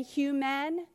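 "human" as hyoo-man, asked with a rise; slow "human?" with an unblurred second vowel; spelling pronunciation. Shown as a demonstration. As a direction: question "human" with a full "man" at the end. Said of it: "No, we say human".

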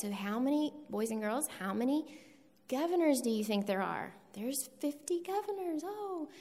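bright questioning voice for a class; kindergarten teacher voice. Shown as a demonstration. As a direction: quiz the little ones with a high, sing-song lilt. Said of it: "in my kindergarten voice".

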